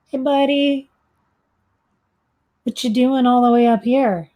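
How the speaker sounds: coaxing, high-pitched hello; friendly call-out. Acted. Greets the owl in character.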